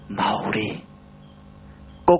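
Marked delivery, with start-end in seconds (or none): 0.1-0.7 a faint voice with almost no air left; sắp hết hơi; breathless voice. Acted. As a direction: say it as if out of breath, half lower and softer. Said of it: "giọng như không còn hơi nữa".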